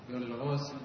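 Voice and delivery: usual speaking voice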